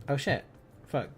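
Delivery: ordinary delivery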